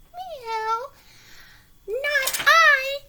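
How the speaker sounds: a prissy cat saying no. A narrator's reading. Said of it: "said the kitty cat".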